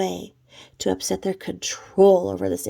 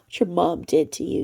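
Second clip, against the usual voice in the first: mocking Jules; 3 dB louder than usual. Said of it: "she goes".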